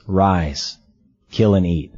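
inner voice of God. Retold or imitated. Voice heard while hunting.

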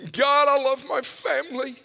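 high, loud, desperate prayer; crying out. Retold or imitated. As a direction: cry out to God for your family, imitating a pleading father. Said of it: "my dad is crying out".

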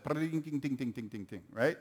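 light mock tinging of a cheap drum set; soft imitated cymbal tings. Father imitates son's cheap drum set with soft tings. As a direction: tap out soft ting sounds with the voice.

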